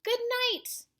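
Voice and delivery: high, loud call downward; yelled good night. Acted. Child yelling good night down to monster under bed. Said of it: "I yelled down to the chap".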